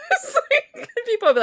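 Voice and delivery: laughing high through the words. Real, not acted. Friend recounting her food mix-ups, laughing. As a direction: let the laugh break over the line.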